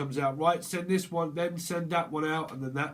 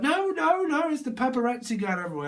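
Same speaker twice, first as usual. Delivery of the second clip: much higher, louder and faster alarm; high panicked cry; 10 semitones higher, 5 dB louder than usual. Retold or imitated. Onlooker panicking about paparazzi in the crowd, high frantic cry. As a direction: squeal out a frantic warning.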